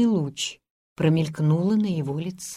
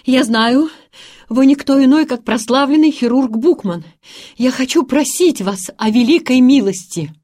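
out of breath; 6 semitones higher, 8 dB louder than usual. A narrator's reading. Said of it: "задыхаясь и подкатывая".

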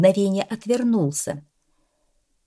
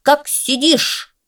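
very high, loud and slow; the coach cat barking at the dog; barked order; 9 semitones higher, 4 dB louder, slower than usual. A narrator's reading. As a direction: bark it like a drill coach. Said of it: "рявкнул на пса".

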